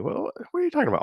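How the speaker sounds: high, fast, incredulous protest; comically baffled objection; mock bafflement. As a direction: rush it out in high-pitched disbelief. Acting out confusion at the title.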